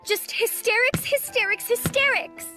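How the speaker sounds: hysterics hit hard, each time high; stamping each word. A narrator's reading. Mary stamps her foot on each word, hysterics hit hard. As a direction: punch hysterics with every stamp. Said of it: "stamped her foot each time she said the word".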